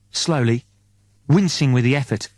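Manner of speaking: ordinary delivery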